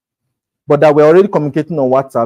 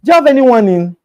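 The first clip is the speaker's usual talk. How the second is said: eager client; 11 semitones higher, 4 dB louder than usual. Retold or imitated. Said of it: "Next question you'll go is".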